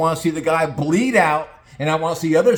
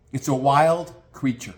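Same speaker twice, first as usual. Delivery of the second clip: ordinary talk, nothing marked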